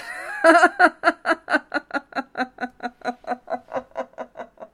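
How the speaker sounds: laughing till crying; real laughing fit; teary helpless laugh. Real, not acted. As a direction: shriek of laughter that won't stop. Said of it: "I just started to laugh".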